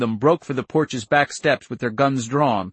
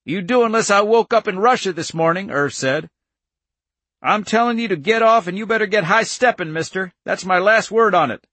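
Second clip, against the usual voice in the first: raised, high-pitched angry order; angry standoff; 8 semitones higher, 4 dB louder than usual. A narrator's reading. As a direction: tell him off, loud and high.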